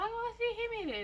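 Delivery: put-on high whiny child voice; tattling kid. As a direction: tell on her like a hurt kid. Kids tattling after toy thrown.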